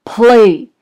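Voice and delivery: one loud roared command; giant's roar. A narrator's reading. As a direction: roar the order at the harp. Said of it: "roared the giant".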